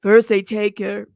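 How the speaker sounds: loud put-on baritone; Elizabeth Holmes voice. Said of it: "I could do the voice".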